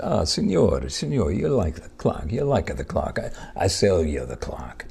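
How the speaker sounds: a smooth Italian hustler selling; Italian accent. Retold or imitated. Italian gentleman offers a tourist the clock, light Italian accent.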